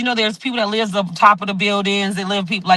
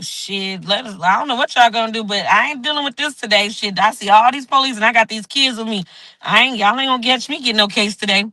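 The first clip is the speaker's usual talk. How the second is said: ordinary talk, nothing marked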